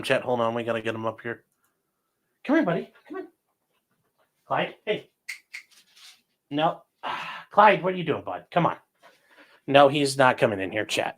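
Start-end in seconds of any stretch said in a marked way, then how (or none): 2.4-8.8 talking to a dog. Real, not acted. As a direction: gently coax a skittish dog closer. Coaxing dog to come in.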